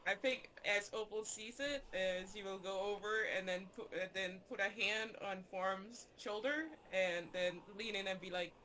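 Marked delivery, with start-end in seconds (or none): none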